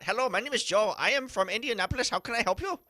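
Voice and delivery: high, put-on Indian accent call-center greeting; cheery mock Indian accent helpdesk voice; mock Indian tech support accent. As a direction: play the scripted Indian support agent. Imitating overseas tech support.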